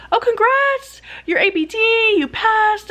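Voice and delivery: high-pitched celebratory cheer, imitating the committee; mock-gushing good news, pitch jumping up; excited congratulations. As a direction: sing out congratulations, high and delighted. Committee announces she passed.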